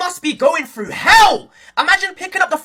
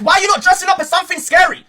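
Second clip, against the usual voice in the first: ordinary talk, nothing marked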